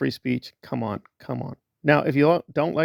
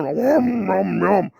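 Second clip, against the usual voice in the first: mock munching; 4 semitones higher, much slower than usual. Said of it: "And then".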